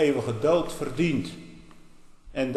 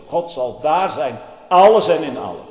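rising proclamation; 4 semitones higher, 11 dB louder than usual. Real, not acted. Preacher exalting heaven.

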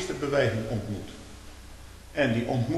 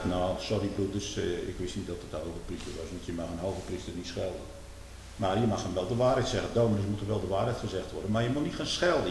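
contrite apology; 6 semitones lower, 7 dB quieter than usual. Acted. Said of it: "hij zegt".

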